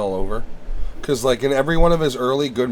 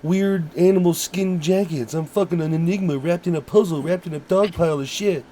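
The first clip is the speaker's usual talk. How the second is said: Steven Seagal impression; 5 semitones higher than usual. Mocking Seagal bragging about his jackets, higher. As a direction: brag like a self-important tough guy. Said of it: "talked like this".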